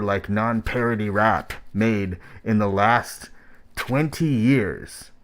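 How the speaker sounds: usual speaking voice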